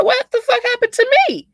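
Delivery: high-pitched disbelieving outburst; incredulous. Retold or imitated. Retelling her reply to the apology, high incredulous squeal. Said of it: "I'm like".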